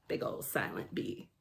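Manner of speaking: hushed secret whisper. Acted. Reader whispers a sly aside to listeners.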